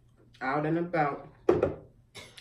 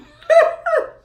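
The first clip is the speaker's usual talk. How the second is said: sudden loud high laugh; high burst of laughter; 16 dB louder than usual. Real, not acted.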